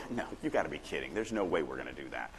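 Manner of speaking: mock incredulous no, dropped low and quiet; pushback. Shown as a demonstration. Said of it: "some type of pushback or whatever".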